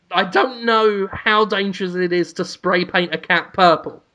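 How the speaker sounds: ordinary talk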